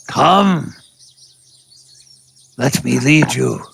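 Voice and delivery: put-on a beaten old hunter who stays dignified and turns hospitable; defeated old tabaxi hunter. DM voices the bound tabaxi hunter inviting the party to his den, grand old hunter voice.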